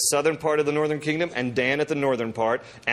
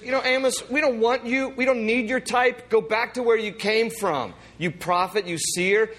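higher, heated dismissal; worked up; 8 semitones higher than usual. Acted. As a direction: tell him to get lost, all worked up. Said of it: "Amaziah gets all worked up".

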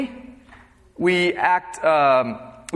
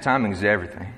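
put-on southern twang accent; 10 semitones lower, 3 dB quieter, much faster than usual. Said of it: "he said in his southern twang accent".

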